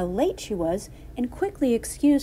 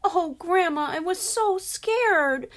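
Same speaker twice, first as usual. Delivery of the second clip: put-on high, drawn-out little-girl wail; mock sobbing; 10 semitones higher, 4 dB louder than usual. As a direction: act a tearful child pouring out the scare.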